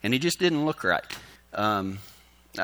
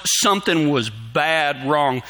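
ordinary delivery

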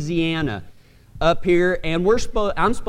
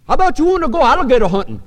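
put-on Cajun accent; 6 semitones higher, 4 dB louder, much faster than usual. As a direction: mimic a Louisiana Cajun hunter, as if chewing. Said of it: "like, a piece of chicken in his mouth".

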